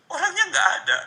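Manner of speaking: anguished high, loud outburst; seruan putus asa. Real, not acted. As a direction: cry out in helpless regret, high and loud. Anguish that uncle is gone. (seruan pilu bernada tinggi)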